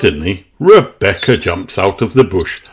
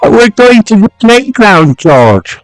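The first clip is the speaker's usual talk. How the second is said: high, louder coaxing; Peppa to her brother; 14 semitones higher, 4 dB louder than usual. A narrator's reading. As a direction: pitch up to cheer up little brother. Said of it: "says Peppa".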